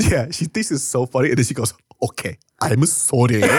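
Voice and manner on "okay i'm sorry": mocking, exaggerated fake apology; sarcastic apology. Mother apologizes to son after laughing in his face, sarcastic. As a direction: imitate a mother's asshole apology. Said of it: "in the most fucking asshole, sarcastic".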